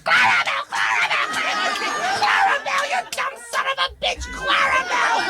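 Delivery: put-on screaming kids. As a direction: scream it like a mob of children. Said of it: "all the little kids would scream".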